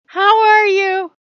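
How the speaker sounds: very high, loud and slow how are you; slow drawn-out cat voice. Pretend. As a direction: stretch it out like a begging kitten. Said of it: "being like".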